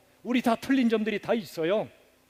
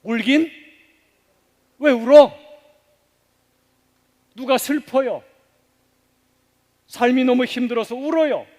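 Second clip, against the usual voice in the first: loud, higher, impatient rebuke of a crying person; mock scolding, louder on why are you crying; 4 semitones higher, 5 dB louder than usual. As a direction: snap at someone for crying, half-acted. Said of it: "우리는 흔히 말하죠".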